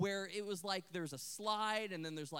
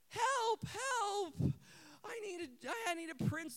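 high mock damsel call, easing off; imitated girl's cry; 10 semitones higher, slower than usual. Girl up the tower yet another time, imitated cry for help. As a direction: play the girl calling for rescue, losing force. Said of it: "she goes back up into the tower".

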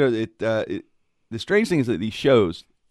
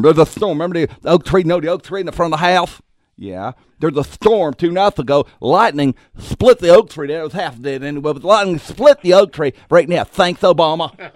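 imitating cousin Victor; 8 semitones higher, 9 dB louder than usual. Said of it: "He'll be like".

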